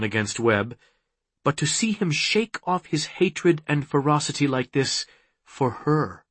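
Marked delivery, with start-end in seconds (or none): none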